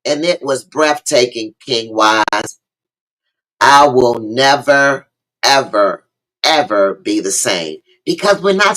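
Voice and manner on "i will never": ordinary delivery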